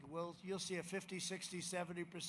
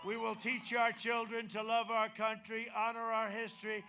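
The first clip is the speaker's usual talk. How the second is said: loud, rousing campaign promises; rally the crowd with conviction; rally speech; 3 semitones higher, 7 dB louder than usual. Acted.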